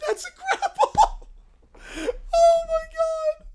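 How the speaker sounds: cracking up helplessly; laughing so hard the voice climbs; real laughing fit. Real, not acted. Streamer loses it laughing.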